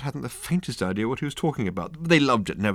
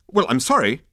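higher, louder offended apology; huffy; 8 semitones higher, 6 dB louder than usual. A narrator's reading. Consultant objects to Ford, huffy. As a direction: take offence while apologising. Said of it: "said the man huffily".

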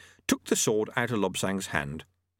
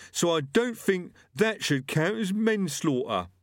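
lightly voiced thug; 8 semitones higher than usual. A narrator's reading. Gripper voice.

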